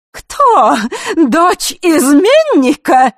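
high, loud, drawn-out mockery; guffawing scorn. A narrator's reading. Martinova scoffs at the proposed witness, mockingly. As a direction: jeer in a high, incredulous voice. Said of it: "Мартинова гоготнула".

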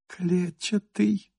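soft breathy whisper, slow. A narrator's reading. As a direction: hush it out, barely voiced. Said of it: "прошептала Кира".

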